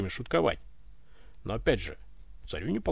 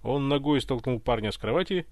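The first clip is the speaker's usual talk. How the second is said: usual speaking voice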